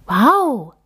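put-on a child thrilled by success; amazed cry. Emma finishes the puzzle and cries out in wonder. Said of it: "ruft Emma".

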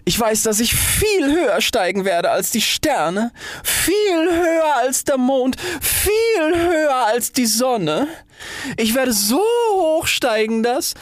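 very high, slow, stretched crowing; ecstatic boasting. A narrator's reading. Rocket boasting as it takes off, high ecstatic cry.

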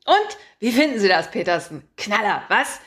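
loud, brash editor barking orders; a pushy chief editor who loves his own voice; booming boss. Acted. Chief editor calling his reporter, booming and pushy.

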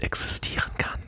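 mysterious hushed whisper. Acted. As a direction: breathe it like a secret. Mysterious hush after spoiler.

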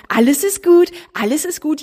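high, loud hammed-up comforting; mock clownish soother. Someone clowning for a crying person, high mock-cheerful. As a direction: play the clown cheering up a crier. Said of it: "jemand macht vor dir einen Clown".

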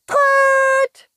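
very high, loud trumpeting toot. Acted. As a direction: blast it like a toy trumpet. Worm trumpets again.

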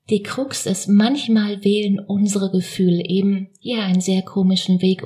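ordinary talk

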